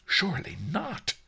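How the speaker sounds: high, shocked disbelief; hushed high astonishment; gasping. A narrator's reading. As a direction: gasp it as the appalled merchant. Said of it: "the merchant gasped".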